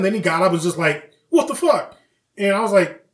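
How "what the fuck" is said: high mock-outraged exclamation; indignant shock. Bully whose ear was just ripped off, indignant. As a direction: burst out high in disbelief.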